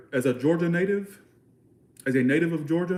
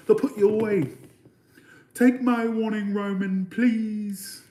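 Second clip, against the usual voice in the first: put-on high, over-the-top British accent; exaggerated British alter ego, pitched way up; 7 semitones higher, 3 dB louder, slower than usual. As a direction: scold theatrically in a hammed-up British accent. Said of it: "let me do the [name] British accent".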